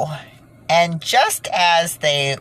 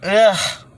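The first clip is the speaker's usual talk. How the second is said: a short groan of disgust, a bit higher and louder; 5 semitones higher, 5 dB louder than usual. Real, not acted. Naming what she feels, a disgusted groan. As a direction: push out a groan of revulsion. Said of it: "It's like a".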